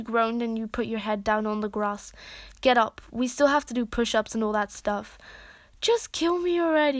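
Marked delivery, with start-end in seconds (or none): none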